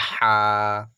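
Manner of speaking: হা long and loud from the middle of the throat. Shown as a demonstration. Teacher shows articulation point, হা from mid-throat. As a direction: push a held হা out from inside the throat. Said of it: "গলার ভেতর থেকে মাঝখানে".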